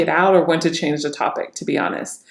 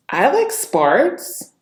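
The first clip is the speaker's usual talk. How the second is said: slow, higher, rising in surprise; surprised; 5 semitones higher, much slower than usual. Shown as a demonstration. Coach demonstrating surprise on a sample line, surprised. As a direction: stretch it out with a surprised lift.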